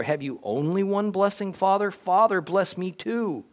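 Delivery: high-pitched, mildly anguished begging; tearful plea. A narrator's reading. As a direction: plead upward to a father, drawing out the final appeal. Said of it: "Esau wept aloud".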